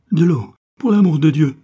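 loud, high pained shout; the burned baron screams for water; screaming in agony. Acted. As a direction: howl for water through the burning. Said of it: "hurlait-il".

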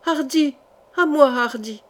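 lightly voiced frightened child, starting high; high first call for help, then falling plaintively; terrified little girl. A narrator's reading. Little girl calling her protector as she is carried off, mildly terrified. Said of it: "la plainte terrifiée d'une voix de petite fille".

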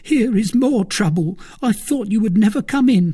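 a jittery valet blurting out news; frightened servant Planchet. A narrator's reading. Planchet voiced by narrator.

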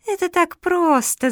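high, drawn-out wheedling tease; tittering beast. A narrator's reading. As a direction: say it slowly with a lightly mocking titter. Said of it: "захихикала зверюга".

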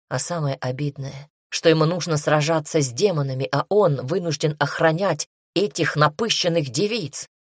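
plain reading voice

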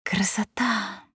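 slow, soft, dreamy sigh of pleasure; blissful. Acted. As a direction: breathe it out in bliss. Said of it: "блаженно прикрыв глаза, проговорила".

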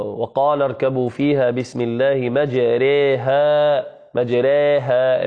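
slow Quran recitation with the imala on مجريها. Shown as a demonstration. Teacher reciting the verse to show Hafs's single imala. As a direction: recite مجريها slowly, bending the long a toward e. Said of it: "وهي قول الله سبحانه وتعالى".

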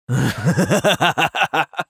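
high crazed cackle; mad laughter. Acted. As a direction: burst into a wild battle laugh. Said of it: "самопроизвольный безумный смех".

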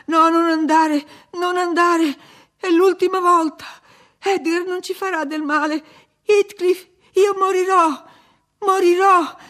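very high, loud desperate pleading; screaming. A narrator's reading. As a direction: scream for them to stay, frantic. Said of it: "urlò".

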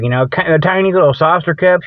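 ordinary talk, nothing marked

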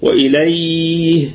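"وإليه" with the ياء held at medium length, four counts; "وإليه" slowly recited with a moderate hold on the ياء; medium madd. Shown as a demonstration. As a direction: stretch "وإليه" moderately before the ه. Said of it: "بالتوسط".

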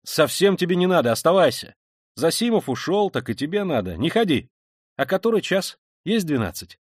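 high, animated, insistent chatter; agitated liveliness. A narrator's reading. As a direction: press them with restless energy. Animated man orders friend to stay, prods others.

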